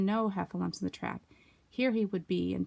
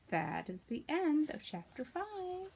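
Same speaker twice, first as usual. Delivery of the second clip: gentle sign-off; 7 semitones higher, 6 dB quieter, much slower than usual. Real, not acted. Signing off to class.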